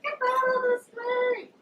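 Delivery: high, slow stretched-out yell; hammed-up yell at the man in the street; retold shout. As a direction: holler it, stretching each word. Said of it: "I was like".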